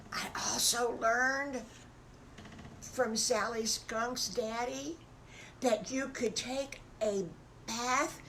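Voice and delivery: a hint of wide-eyed wolf cub; amazed little wolf. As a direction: marvel slowly at a big discovery. Little wolf reveals his discovery.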